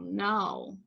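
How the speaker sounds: usual speaking voice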